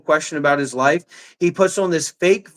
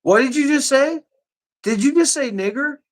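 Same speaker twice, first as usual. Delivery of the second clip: higher, sharp what did you say; shocked disbelief; 7 semitones higher than usual. Real, not acted. Streamer catching a slur, shocked disbelief. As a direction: pounce on a word you just heard. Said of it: "I swear to God".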